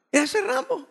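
high, astonished squeak; can't believe that's the dog; a visitor amazed at the tiny chihuahua named Rambo; incredulous. Retold or imitated. Brother sees the tiny dog.

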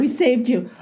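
airy, breathy "Whew" of a rescuer; mock relief. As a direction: play the inner guardian who just averted tears. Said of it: "And she just starts laughing now".

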